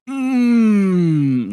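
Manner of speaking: a high, loud, mock-pleased "Mm". Streamer mocking how you're supposed to react to hot coffee, high mock hum. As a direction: hum as if sipping a hot drink and pretending to love it. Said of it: "supposed to go".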